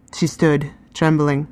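usual speaking voice

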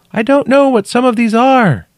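very high, loud call from below. A narrator's reading. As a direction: call up in puzzlement. Trapped woman calling up.

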